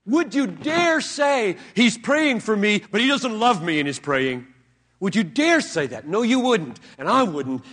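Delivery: loud, emphatic challenge to the hearers; forceful pulpit challenge; full-force preaching. Acted. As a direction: press the question hard on them.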